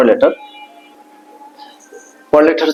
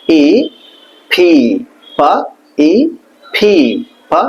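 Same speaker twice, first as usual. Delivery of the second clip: normal talking voice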